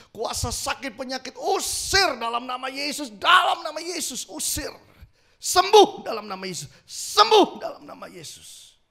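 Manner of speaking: loud, high, rising healing command; shouted prayer. Retold or imitated. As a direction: cast out sickness with full force. Retold fervent healing prayer. (doa kesembuhan berseru lantang)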